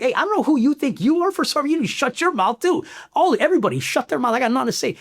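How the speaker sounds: reliving the fight, voice high and pushed; heated retold outburst. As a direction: tell the whole room off. Retold blowup at superiors.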